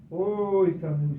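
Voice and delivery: imitating dad exclaiming; retold dad. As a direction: put on dad's loud "oh". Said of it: "Daddy was going".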